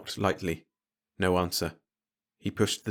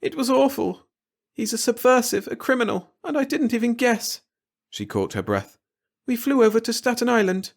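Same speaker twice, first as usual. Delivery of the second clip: put-on much higher, distressed young woman; Glory, upset; 4 dB louder than usual. A daughter telling her father of the proposal, upset and halting. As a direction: blurt it out, still shaken from crying. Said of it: "her words came haltingly".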